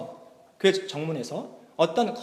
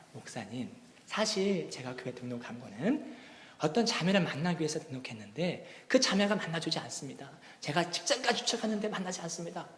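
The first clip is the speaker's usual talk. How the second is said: quiet plea; 2 semitones lower, 8 dB quieter than usual. Retold or imitated. Young man quietly confiding in the pastor, soft. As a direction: confide it shyly.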